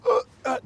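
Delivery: very high strained gasping grunts; imitated choking gasps. Retelling a cellmate choking on gas, very high gasps. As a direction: mimic a big man gasping for air.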